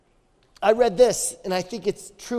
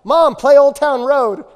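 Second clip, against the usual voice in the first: slow, loud, higher call to mom; child yelling from a closet; 5 semitones higher, 8 dB louder, slower than usual. Retold or imitated. Son yelling to mom to play his song, loud slow call. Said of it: "he yells from the closet".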